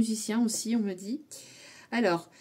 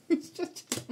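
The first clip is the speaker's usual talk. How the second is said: whispered through a giggle, higher; laughing whisper; 9 semitones higher than usual. Pretend. Reader giggling the song title to herself in a whisper. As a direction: breathe it out laughing.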